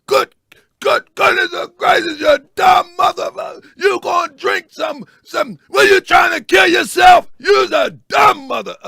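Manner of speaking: high-pitched shouted impersonation of a scolding dad; loud cussing father. Retold or imitated. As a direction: bellow in disbelief, imitating an angry father. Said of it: "That's how my father get down".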